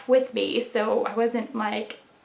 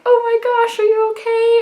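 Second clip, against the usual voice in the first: high, loud mock alarm of worried parents; 12 semitones higher, 6 dB louder than usual. Parents reacting to her tears with high loud alarm. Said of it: "my parents were like".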